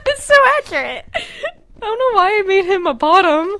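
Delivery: high, laughing-crying voice. Real, not acted. Cracking up at her own story, laughing. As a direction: squeal through tears of laughter.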